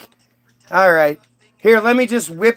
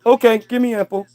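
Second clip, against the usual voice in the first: dim caveman Adam. Acted.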